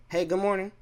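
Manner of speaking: imitated high, friendly hello; chirpy greeting. Worker greets cold coworker, high chirpy hello. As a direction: pitch it up like a polite greeting. Greeting her again next day.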